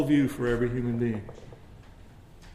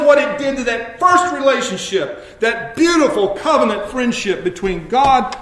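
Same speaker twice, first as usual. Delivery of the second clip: higher, louder preacher's cry against sin; preaching at full force; 10 semitones higher, 7 dB louder than usual. Acted. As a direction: build to a shout over sin.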